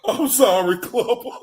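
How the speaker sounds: ordinary talk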